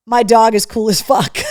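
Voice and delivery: loud gleeful read of the sock text; shouted with delight. Real, not acted. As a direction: belt out the slogan. Reading socks aloud, shouting.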